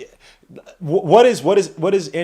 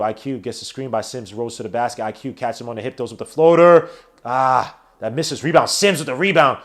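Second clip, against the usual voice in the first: sports announcer calling the game; live play-by-play; 6 semitones lower than usual. Acted. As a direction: call it like a broadcast announcer.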